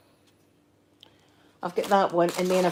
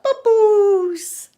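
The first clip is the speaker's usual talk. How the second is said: put-on very high, loud toddler squeal; high-pitched imitation of a small child; imitating toddler grandson; 12 semitones higher, 6 dB louder than usual. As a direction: mimic a wee Scottish tot at full volume. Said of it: "He does that".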